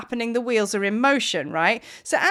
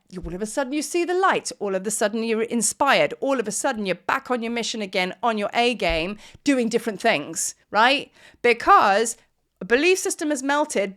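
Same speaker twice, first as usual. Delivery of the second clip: usual speaking voice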